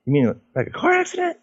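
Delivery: high, rising mock surprise; a surprised father's question; surprised dad. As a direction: imitate dad asking in shock. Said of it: "And he goes".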